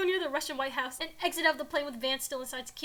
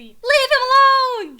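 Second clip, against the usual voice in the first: high, drawn-out angry yell; angry shout; 11 semitones higher, 15 dB louder, much slower than usual. A narrator's reading. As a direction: bellow it slowly, hammed-up. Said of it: "Trump says angrily".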